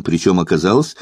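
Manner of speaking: plain reading voice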